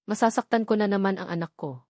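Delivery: plain reading voice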